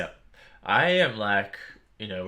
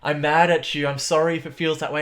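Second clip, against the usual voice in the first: apologetic; 9 semitones higher, 4 dB louder than usual. Retold or imitated. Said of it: "It's like".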